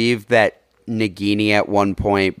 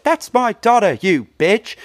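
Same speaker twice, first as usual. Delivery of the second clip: mock furious mother; 11 semitones higher, 3 dB louder than usual. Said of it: "he's like".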